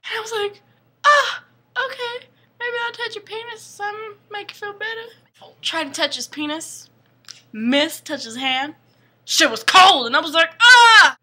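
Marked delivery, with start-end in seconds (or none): 1.1-5.5 high, softening put-on girly voice; mock timid girl. Girl tries to make her angry rescuer feel better, high timid voice. 10.6-11.2 sudden loud, high yelp; mock startled scream. Girl touches his cold hand, loud high mock scream.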